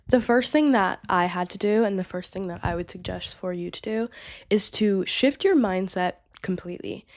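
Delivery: normal talking voice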